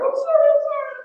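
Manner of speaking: piercingly high, loud mock wail; imitated bawling. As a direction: squeal the apology as if bawling. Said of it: "She's running to me bawling".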